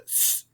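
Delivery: "S" as a drawn-out hissed consonant, not the letter name; S sound. Shown as a demonstration. Teacher contrasting it with a K sound, "S" as a sustained hiss. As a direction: make "S" a soft, long sss noise. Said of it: "pronounce the C like an S".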